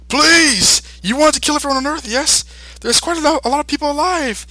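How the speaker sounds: high mock pleading voice; hammed-up plea to a villain. Piccolo voiced bargaining with Buu.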